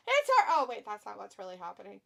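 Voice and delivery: sudden deflating aside; mock panic dropping away. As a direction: break off the hysteria into matter-of-fact talk. Drops the mock panic mid-word.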